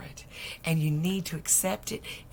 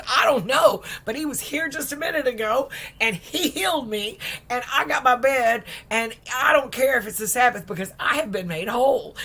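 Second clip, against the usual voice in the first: higher, louder elated insistence; defiant joy; 6 semitones higher, 6 dB louder than usual. Retold or imitated. Healed man tells his friends he's whole, elated and defiant. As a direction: throw off the Sabbath rule, overjoyed.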